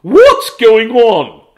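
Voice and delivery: loud, high, slow demanding question; teacher questioning the girl, loud and slow; stern teacher. Acted. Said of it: "said Missus Gomez to the girl".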